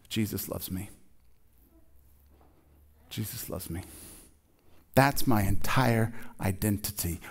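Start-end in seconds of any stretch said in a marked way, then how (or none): 0.1-3.8 much softer, hushed and tender; soft tender confession. Shown as a demonstration. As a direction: say it softly like a treasured truth. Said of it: "the only thing that I can say about myself".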